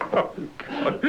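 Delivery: high, airy laugh into a gasped oh God; despairing at herself, giggling; breathless giggle. Real, not acted. Newsreader ruining another take, breathless giggle.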